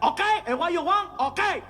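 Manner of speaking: high-pitched, put-on irritated waiter; pissed-off waiter. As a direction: imitate a miffed waiter giving in. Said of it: "all pissed off".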